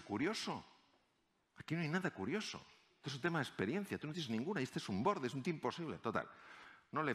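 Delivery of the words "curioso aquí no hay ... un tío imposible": scornful. Retold or imitated. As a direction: look down on the rookie. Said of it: "le miraron con desprecio". (colegas veteranos despreciando al recién llegado, con desdén)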